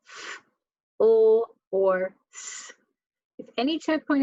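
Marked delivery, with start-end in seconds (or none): 0.1-2.7 "f, o, or s" as fff, ull, sss; each consonant held alone between gaps; isolated letter sounds. Shown as a demonstration. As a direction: sound out "f, o, or s" slowly, not letter names. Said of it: "if it ends in final".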